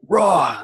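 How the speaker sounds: loud, high mock roar; angry roar. Shown as a demonstration. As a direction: roar as if lashing out in rage. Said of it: "Rather than being like".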